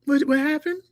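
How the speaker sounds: high-pitched mock-sleepy child; put-on innocent little voice; faking sleep. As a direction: imitate a kid feigning innocence on waking. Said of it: "Do that fake sleep?".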